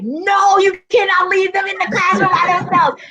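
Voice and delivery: horrified she left them alone; alarmed protest. Retold or imitated. Running down hallway alarmed.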